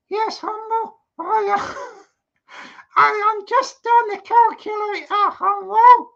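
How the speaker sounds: the doll breaks bad news sweetly; high squeaky wife-doll voice; dummy voice. Dummy Amanda checks the money.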